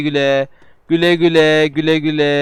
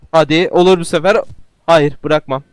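ordinary talk

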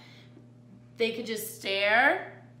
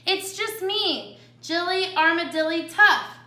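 middle armadillo sister; 7 semitones higher, 6 dB louder than usual. A narrator's reading. Middle sister answers the coyote in the tunnel, high-pitched. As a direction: answer up high, stretching the words.

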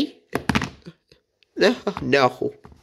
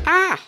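startled yelp. Real, not acted. Toy startles him.

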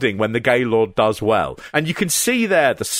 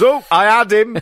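higher, louder comic wrestler voice; hammed-up exclamation, pitched up; mock dismay; 7 semitones higher, 5 dB louder than usual. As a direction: imitate a wrestler who's just been outdone. Voicing wrestler losing his hold.

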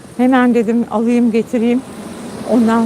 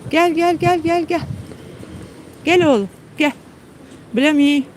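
coaxing the dog; 4 semitones higher than usual. Real, not acted. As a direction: coax him in gently. Calling the dog.

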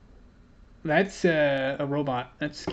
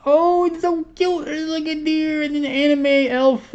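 cute gushing; 11 semitones higher, 4 dB louder than usual. Real, not acted. Opener gushes over a cute elf card, cooing high. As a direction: coo like you just saw a puppy.

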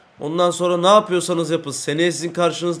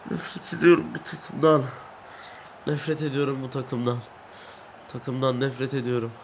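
mock crying; 2 semitones lower, 7 dB quieter than usual. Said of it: "Nasıl rol yaptım ağlama rolü?".

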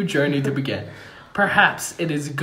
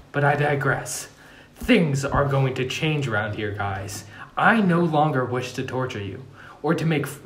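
ordinary delivery

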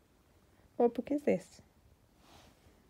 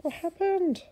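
higher, louder question in shock; surprised; 9 semitones higher, 5 dB louder, slower than usual. Real, not acted. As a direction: ask in alarm. Parent reacts to story.

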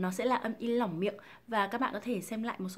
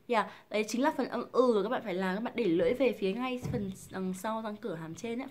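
ordinary delivery

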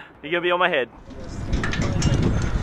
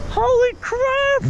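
scared yelling; 6 semitones higher, much slower than usual. Real, not acted. Swinging on the bungee.

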